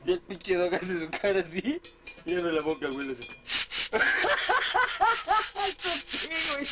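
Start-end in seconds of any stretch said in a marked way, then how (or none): 3.3-5.6 hysterical laugh. Real, not acted. Laughing fit at friend's gesture.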